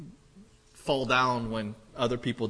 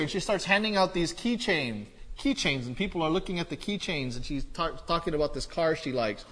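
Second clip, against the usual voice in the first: ordinary talk, nothing marked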